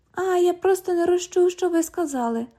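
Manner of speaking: high, sing-song copy of the cop; mocking mimicry. London parrots the cop's excuse, high and sing-song. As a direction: parrot him high to annoy him.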